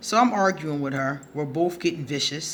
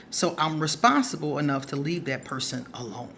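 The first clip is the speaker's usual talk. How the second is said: usual speaking voice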